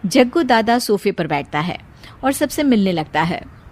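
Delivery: ordinary delivery, nothing marked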